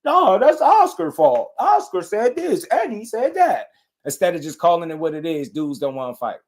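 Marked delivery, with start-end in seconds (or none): 0.0-3.7 high, drawn-out mock whine; mocking excuse-makers. Streamer mimicking fans who blame Oscar, high mocking voice. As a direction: imitate them in an exaggerated, swooping high voice. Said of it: "then they say".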